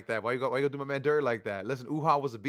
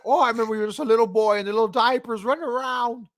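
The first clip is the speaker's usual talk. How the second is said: high, loud, raspy Piper promo voice; Roddy Piper impression; 8 semitones higher, 6 dB louder than usual. Host mocking a wrestler in Piper's promo voice, much higher and louder. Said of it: "I should do the promo in his voice".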